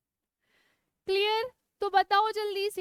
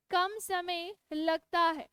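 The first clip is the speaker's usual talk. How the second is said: slow, deliberate dictation; slow it down so they can copy; 2 semitones lower, slower than usual. Shown as a demonstration. Teacher repeating the line for students to copy, slowly. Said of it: "उदाहरण लिखिएगा मेरे साथ".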